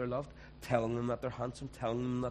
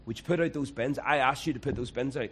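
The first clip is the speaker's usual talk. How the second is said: louder, raised-voice nag about the bins; nagging wife; 3 semitones higher, 6 dB louder than usual. Retold or imitated. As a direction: scold him to take the bins out. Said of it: "easier than saying".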